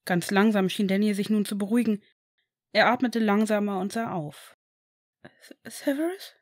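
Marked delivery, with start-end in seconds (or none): 5.2-6.4 put-on frightened boy's breathy whisper; breathed. A narrator's reading. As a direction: barely breathe the name. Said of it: "hauchte er". (gehauchtes Flüstern)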